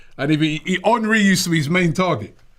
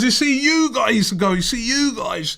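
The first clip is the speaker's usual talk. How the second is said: slow, higher lecturing mock Scottish; bad Scottish accent; 6 semitones higher, slower than usual. Scottish fan lecturing the guys about Henry, mock Scottish accent. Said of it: "That's not a very good Scottish accent".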